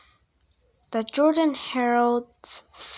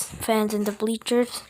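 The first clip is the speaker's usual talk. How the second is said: ordinary delivery, nothing marked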